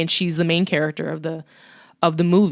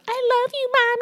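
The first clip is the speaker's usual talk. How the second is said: put-on much higher, tiny child voice; sweet little girl; faster than usual. Little girl telling her mom she loves her, sweet child voice. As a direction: coo it like a small daughter. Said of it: "she's just like".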